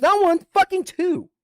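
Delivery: loud, very high-pitched indignation; outraged emphasis. Real, not acted. Storyteller outraged at two counseling statements, high indignant cry. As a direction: shoot the voice way up in outrage.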